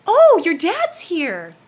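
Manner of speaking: slow, high, loud and airy; healer's announcement. Retold or imitated. The healer telling her father has arrived, slow and high. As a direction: draw it out like a revelation. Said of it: "And then she goes".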